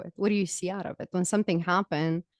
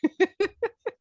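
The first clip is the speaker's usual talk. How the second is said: high laugh; 3 dB louder than usual. Real, not acted. Laughs at own story.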